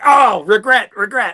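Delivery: high, loud mock wince of regret. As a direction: yelp out a playful regret. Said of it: "and I was like".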